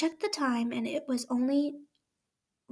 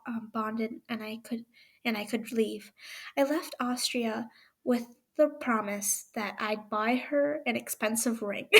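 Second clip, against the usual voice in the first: ordinary delivery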